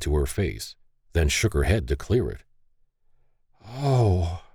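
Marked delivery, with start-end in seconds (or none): 3.6-4.4 higher weak gasped oh; feeble gasp. Acted. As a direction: gasp it weakly. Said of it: "she feebly gasped".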